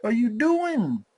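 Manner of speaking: high, slowing incredulous outburst; can't believe what the characters are doing; high pitched what the fuck; exasperated disbelief. Retold or imitated. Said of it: "and I'm like".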